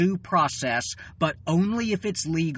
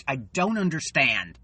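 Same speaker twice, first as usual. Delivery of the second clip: ordinary delivery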